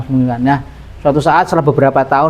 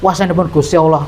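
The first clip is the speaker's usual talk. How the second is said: usual speaking voice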